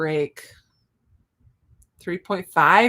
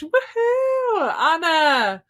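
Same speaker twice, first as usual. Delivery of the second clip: much higher shouted "woohoo"; excited whoop; 9 semitones higher, 4 dB louder, much slower than usual. Real, not acted. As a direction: whoop for them at full delight. Cheering another finished book.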